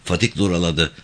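usual speaking voice

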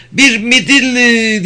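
high, drawn-out delighted squeal; stretched, high-pitched exclamation of joy; excited cry. A narrator's reading. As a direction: cry out like a thrilled little girl. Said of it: "diye haykırdı".

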